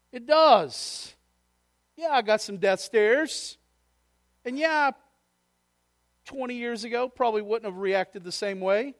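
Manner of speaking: fiery preacher; full-force preaching. Acted. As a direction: preach it out to the back row.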